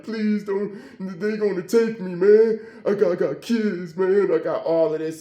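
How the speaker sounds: high, tearful begging; re-enacted weepy plea; imitating crying. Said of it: "And he crying".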